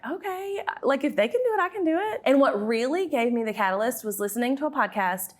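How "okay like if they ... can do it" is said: higher, perky self-pep talk; mock resolve. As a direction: psych yourself up lightly. Retold inner resolve.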